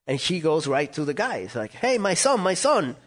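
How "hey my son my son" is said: high-pitched, insistent mock call; eager mom. Mom accosting the scout about her son, eager and high. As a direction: imitate an excitedly boasting mother.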